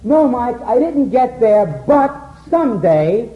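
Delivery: high, loud and slow, trailing into someday; wistful someday. Retold or imitated. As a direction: stretch it out, leaving it unfinished. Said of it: "And Joe says".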